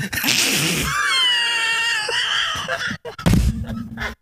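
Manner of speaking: high shrieking laugh; burst of laughter. Real, not acted. The teller losing it at his own punchline, shrieking laughter. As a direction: howl with laughter.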